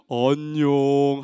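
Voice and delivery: very low, drawn-out hello; low, lazy-paced puppet hello; one friend's greeting. Acted. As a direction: greet slowly in a deep character voice. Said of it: "한 친구는".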